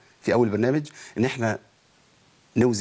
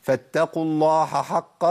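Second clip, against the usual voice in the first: each sound of فاتقوا الله حق pulled apart, slow; slow motion; much slower than usual. Shown as a demonstration. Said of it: "بنطقها بالتصوير البطيء". (نطق بالتصوير البطيء)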